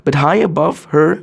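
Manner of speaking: plain reading voice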